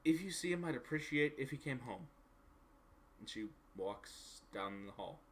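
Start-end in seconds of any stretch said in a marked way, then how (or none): 0.0-2.1 slightly higher, halting in-character voice; start, stop, then give in and ask; lightly voiced visitor, reluctantly asking a favour; hesitant game character.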